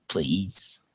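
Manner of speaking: normal talking voice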